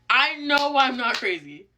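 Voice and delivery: ordinary delivery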